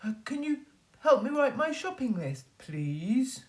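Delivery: butter him up; wolf flattering Wee Willie Winkie. Acted. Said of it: "said Mister Wolf".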